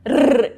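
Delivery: strong tongue-tip trill on its own; rolled R trill. Shown as a demonstration. Teacher showing students how to vibrate, loud rolled R. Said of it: "eu tenho que vibrar".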